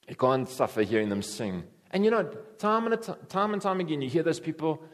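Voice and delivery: usual speaking voice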